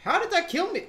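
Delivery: high panicked yelling; raging gamer. Real, not acted. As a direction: scream at the swarm of enemies. Ambushed by enemies, dying.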